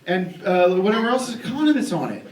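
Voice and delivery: ordinary talk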